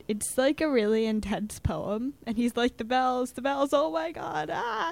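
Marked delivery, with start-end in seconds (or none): 2.8-4.9 mock frantic cry, climbing higher; raised voice building to a small mock scream; screaming performer. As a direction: imitate an intense, overwrought recital. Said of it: "is screaming about bells".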